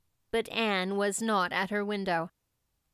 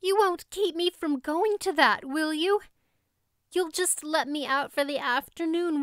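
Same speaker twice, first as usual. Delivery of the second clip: put-on young girl pleading, higher; pleading Anne; 7 semitones higher than usual. A narrator's reading. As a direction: beg to be let out for the picnic. Anne begging to go.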